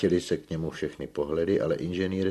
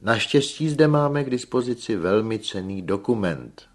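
louder, higher public-presentation voice; lecturing engineer; 6 semitones higher, 8 dB louder than usual. A narrator's reading. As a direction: announce it to the whole room. Engineer presents report to gathering.

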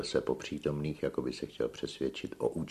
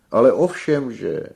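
high, booming burst of confirmation; loud stage exclamation; exclaiming tenor; 10 semitones higher, 14 dB louder than usual. A narrator's reading. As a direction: cry out in grand delight. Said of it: "vykřikl zpěvák".

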